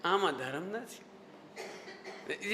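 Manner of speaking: low, soft, drawn-out gentle correction; Todarmal. Retold or imitated. Teacher showing a scholar's tone after praise, measured caution. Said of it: "ये tone".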